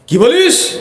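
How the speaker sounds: high-pitched acted outburst; angry demonstration. Shown as a demonstration. Preacher showing how we react when angered, acted angry cry. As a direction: shoot it out like someone losing their temper. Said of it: "আমরা যখন রাগি".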